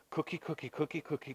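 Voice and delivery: very soft repeated chant; tempting murmur. Acted. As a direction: murmur it like a nagging craving. Temptation's nagging voice.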